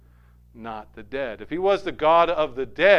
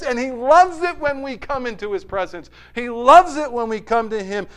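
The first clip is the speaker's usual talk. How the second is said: full preaching voice; 7 semitones higher, 7 dB louder than usual. Acted. Preacher urging the congregation toward God's presence, preaching at full force. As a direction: raise the pitch and pour it out to the congregation.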